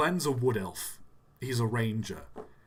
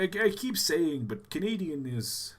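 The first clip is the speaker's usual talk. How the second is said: normal talking voice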